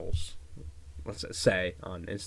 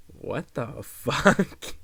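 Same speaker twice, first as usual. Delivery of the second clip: disgusted disbelief; 2 semitones higher, 3 dB louder, much slower than usual. Real, not acted. Reacting to gross comment.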